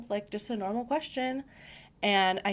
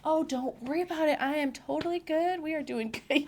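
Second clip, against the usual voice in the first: mock cheerful; 8 semitones higher than usual. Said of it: "I'm like".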